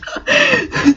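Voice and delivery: a sudden high giggle breaking out mid-reading; can't hold it in, squeaky laugh; high-pitched burst of laughter. Real, not acted. Reader cracking up at the fanfic, high squeaky laugh. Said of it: "I apologise for the laughter".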